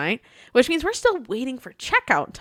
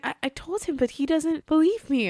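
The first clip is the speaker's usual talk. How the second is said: higher, hurt little voice; upset clerk; 6 semitones higher, 3 dB quieter than usual. Retold or imitated. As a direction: say it high and wounded. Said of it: "She's like".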